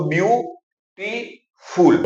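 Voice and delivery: Beautiful broken into three slow parts; teacher splitting beautiful into its three parts; slow syllable split. Shown as a demonstration. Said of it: "तीन अलग अलग शब्द खंडों में".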